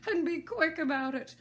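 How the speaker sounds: high, loud bossy Hatter; impatient hatter. Acted. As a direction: hurry them as the Hatter. Hatter voiced in story.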